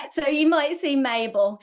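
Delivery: amused, laughing as you speak. Real, not acted. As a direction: carry the giggle into the words. Laughing about her cat.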